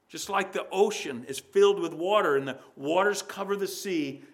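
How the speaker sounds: usual speaking voice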